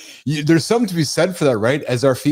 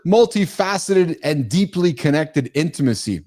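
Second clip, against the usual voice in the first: ordinary talk, nothing marked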